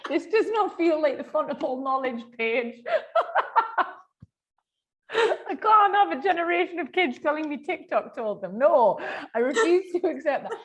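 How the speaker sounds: high, laughing disbelief. Real, not acted. Laughing about TikTok advice.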